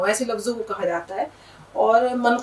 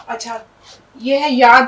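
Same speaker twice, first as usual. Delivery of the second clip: usual speaking voice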